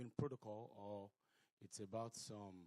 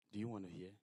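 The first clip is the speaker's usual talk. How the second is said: ordinary talk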